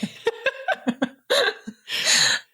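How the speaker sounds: breathy embarrassed giggle; high giggle. Real, not acted. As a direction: burst into a high little laugh. Laughs at own mispronunciation.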